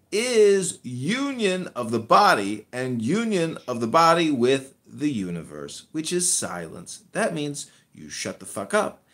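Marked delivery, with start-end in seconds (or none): none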